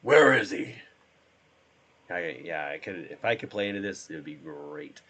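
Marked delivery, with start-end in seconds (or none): 0.0-0.7 loud, raised superhero interrogation; shouted Batman growl. Acted. Quoting Batman questioning someone, loud growled shout. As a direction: roar it like a masked vigilante. More Batman movie quotes.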